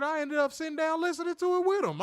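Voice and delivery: a hint of the excited stranger; mildly hammed-up fan; retold fan. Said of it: "He said".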